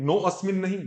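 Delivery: normal talking voice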